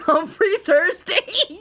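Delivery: cackling in disbelief at the name; laughing. Real, not acted. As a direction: crack up while saying Humphrey Thirsty.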